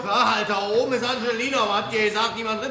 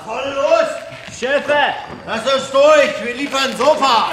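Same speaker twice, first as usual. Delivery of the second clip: pushy calling out; 5 semitones higher, 4 dB louder, slower than usual. Acted. Mover pushing past guard.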